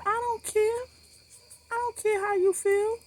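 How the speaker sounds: very high-pitched, indignant dismissal; exasperated re-enacted comeback; retold angry retort.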